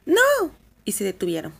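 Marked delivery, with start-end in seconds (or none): 0.1-0.5 a high, loud cry of alarm; the girls stop dead and yell; sudden shout. A narrator's reading. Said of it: "las dos niñas gritaron de repente". (grito repentino, agudo y fuerte)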